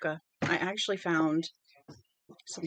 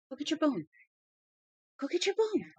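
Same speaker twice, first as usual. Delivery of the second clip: higher, rising sing-song coax; coaxing the dog; 9 semitones higher than usual. Real, not acted. Owner urging her dog to fetch its bone, high coaxing. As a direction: urge the dog playfully.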